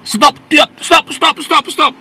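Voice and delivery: high, loud repeated "stop" barked as intruders; mock shouted orders. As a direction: act out attackers yelling at the church. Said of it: "Lama-lama mereka masuk ke gereja".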